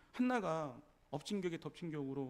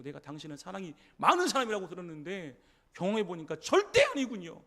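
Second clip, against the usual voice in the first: indignant outburst; 6 dB louder than usual. Pretend. Offended woman snapping at Jesus before walking away, loud and indignant. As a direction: spit out the disappointment.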